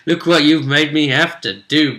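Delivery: ordinary delivery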